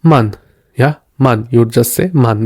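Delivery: "Mann" with a short, quick ah. Shown as a demonstration. Teacher showing learners the short vowel, quick A in "Mann". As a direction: keep the A in "Mann" brief. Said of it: "short A as in Mann".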